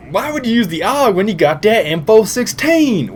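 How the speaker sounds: high-pitched mimicking of a critic; mock complaining viewer. Mimics viewers questioning his gun choice.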